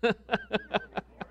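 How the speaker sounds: short high chuckle; brief laugh. Real, not acted. Preacher laughing at his own king joke.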